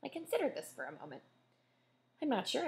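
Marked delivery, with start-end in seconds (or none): 2.2-2.7 uncertain. Acted. Hesitant reply, high.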